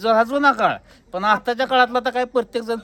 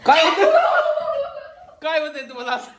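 high voice broken by giggles; laughing; 5 semitones higher than usual. Real, not acted. Asking the laughing company what's wrong, laughing along. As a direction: ask it through helpless laughter. Helpless laughter during the chat.

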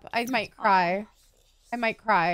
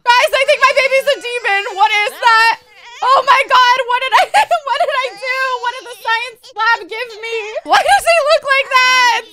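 loud, high alarmed outcry; panicked disbelief, voice raised; scared; 4 semitones higher, 9 dB louder than usual. Real, not acted. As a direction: cry out in comic horror at the sight. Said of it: "I'm so scared".

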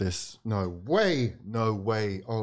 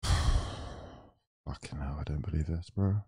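despairing sigh; 13 semitones lower, 8 dB quieter, slower than usual. Real, not acted. Despair as Southampton lead.

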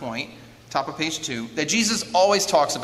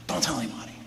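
hushed warning; 2 semitones lower, 6 dB quieter, much faster than usual. Retold or imitated. Acted Jesus hushing the people.

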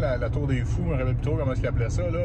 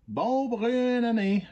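ordinary talk

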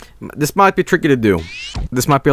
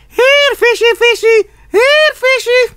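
very high sing-out calling; mock animal call; 4 dB louder than usual. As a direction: coax loudly in a squeaky high pitch.

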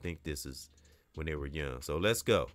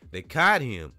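taunting; 4 semitones higher, 9 dB louder than usual. Acted. Bully taunt, reread.

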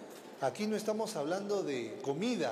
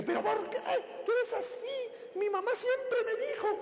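high, shrill mock yell of a nagging wife; wife screeching back at her husband. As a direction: keep nagging at full pitch. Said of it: "Y ella sigue".

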